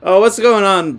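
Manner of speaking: normal talking voice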